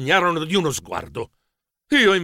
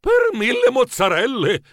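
higher-pitched amazement; astonished exclamation; 7 semitones higher than usual. Acted. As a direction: blurt it out in amazement. Il protagonista esclama meravigliato. (esclamazione stupita, acuta)

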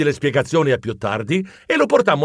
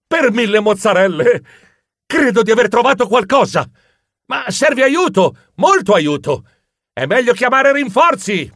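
raised, urgent calling voice; excited cry; 6 semitones higher, 4 dB louder than usual. A narrator's reading. Hero calls his friends for help, excited cry. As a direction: call out the discovery with urgency. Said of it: "gridai, colto da un'illuminazione".

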